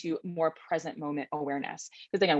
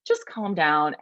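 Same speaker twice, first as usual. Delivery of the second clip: mock calming; 6 semitones higher, 4 dB louder, much slower than usual. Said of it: "someone comes up to you and says".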